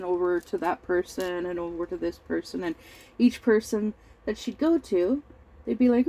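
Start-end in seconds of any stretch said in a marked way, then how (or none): none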